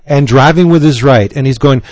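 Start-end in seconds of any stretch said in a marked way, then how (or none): none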